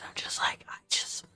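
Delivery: hushed unvoiced whisper. Real, not acted. Streamer whispering so her sister next door won't hear. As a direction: breathe it out as a whisper. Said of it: "I'm gonna have to whisper".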